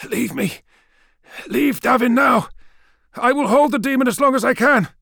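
high, urgent shouted orders; pained, desperate calling out; strained command. A narrator's reading. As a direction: force the orders out while holding on. Weakening lord orders comrades away.

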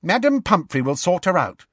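put-on firm, high-pitched girl; no doubt allowed, pitched high. A narrator's reading. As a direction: reassure them with firm confidence. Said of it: "said Alicia Spinett firmly".

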